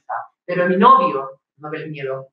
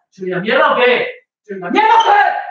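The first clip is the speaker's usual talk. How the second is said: second take shouted much higher; angry yell; 5 semitones higher, 4 dB louder than usual. Acted. Boyfriend escalating at girlfriend, furious shout. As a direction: explode on the repeat.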